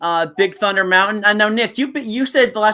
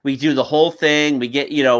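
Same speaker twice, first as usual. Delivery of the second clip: ordinary talk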